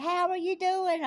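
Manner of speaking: high, loud, drawn-out hello; hammed-up hollered greeting; exuberant greeting. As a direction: call it out big and slow. Acted loud greeting to car passengers.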